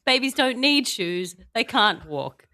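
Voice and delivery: usual speaking voice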